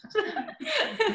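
real, amused laugh up high; helpless high giggle; high-pitched laugh. Real, not acted. Amused by the name confusion.

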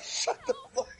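very high squeaky retort through laughter; laughing retort. Retold or imitated. Friend's exasperated comeback, squeaked out through laughter. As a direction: snap it while cracking up.

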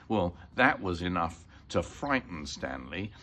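usual speaking voice

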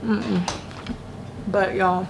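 talking with a mouth full of snack; words muffled around food. Real, not acted. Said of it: "let me not talk with my mouth full".

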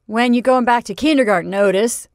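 loud mocking taunt; teasing. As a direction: tease him loudly. Teasing question at party.

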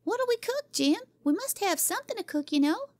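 put-on high, eager child's question; a girl demanding a plan; little girl crying out. Cora asking Jimmy what to cook: eager high child voice. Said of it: "cried little Cora".

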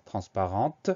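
TRANSPARENTE with the last T released clearly; audible final T. Shown as a demonstration. Teacher demonstrating the feminine ending, final T sounded. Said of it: "on va entendre le son T".

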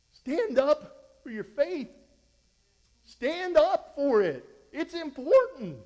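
high, loud and slow exhortation; fired up. Real, not acted. Preacher fired up telling the church to stand, high and loud. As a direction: hammer it home, pushing hard. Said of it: "When I get fired up, my voice goes high".

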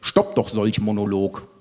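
slow, droning monotone; monotone defiance. Acted. Otto stands up to the ranting monologue, monotone. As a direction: drone it out stubbornly on one note. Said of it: "Trotzt Otto monoton".

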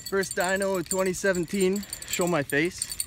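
loud and high with excitement; proudly showing off a catch; excited. Real, not acted. Showing off first fish.

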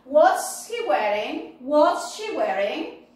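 slow drill questions, each rising at the end; model for repetition. Shown as a demonstration. Teacher reminds the class of both questions, slowly.

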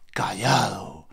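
whispered, very low. Retold or imitated. Telling Juan he kept silent, whispered. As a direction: breathe the word out.

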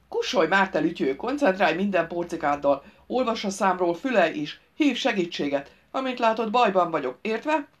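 loud, commanding trapped grouch; irritable driver, exasperated. Acted. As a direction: order the fool to listen.

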